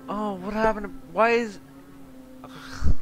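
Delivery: flustered cry of confusion; panicked shout. Real, not acted. Panicked confusion at game events.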